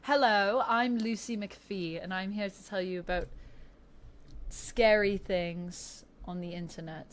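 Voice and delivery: light put-on British accent, bright opening; Lucy impression. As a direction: introduce yourself like a British YouTuber. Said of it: "Lucy sounds kind of like this".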